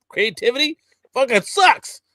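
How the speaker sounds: high, loud exasperated outburst; rising in frustration, voice climbing; exasperated rant. Real, not acted. A podcaster ranting about DC, exasperated and loud.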